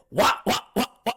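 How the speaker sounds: quick high "wah" bursts; imitated flailing blows. Speaker acts out panicked flailing in water, punchy noises. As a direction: fire off loud mock impact noises.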